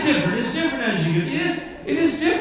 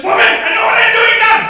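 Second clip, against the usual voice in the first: high, very loud indignant outburst; indignant shout; 10 semitones higher, 11 dB louder than usual. Acted. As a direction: yell it in exasperation, pitched way up. Acted outburst at a heckling woman.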